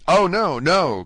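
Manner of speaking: loud, high, urgent protest; cried out. A narrator's reading. Dante protests to the old prisoner, loud and high. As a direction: burst out in alarm, denying it. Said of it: "cried Dante".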